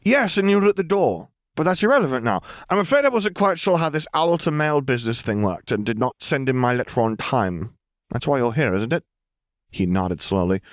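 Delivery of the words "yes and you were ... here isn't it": high-pitched, brisk-mannered chatter easing down; breezy explaining. A narrator's reading. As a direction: rattle off the explanation cheerily, starting high. Lily chirpily explains to dazed man.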